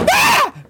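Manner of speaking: high yelling charge; battle cry. Acted. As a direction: burst in screaming.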